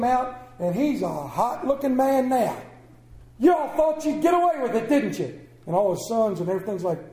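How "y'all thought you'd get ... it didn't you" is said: loud, high excited father; hammed-up hillbilly father shout; mock shout. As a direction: bellow it like a riled old farmer. Pastor retells movie, father voiced.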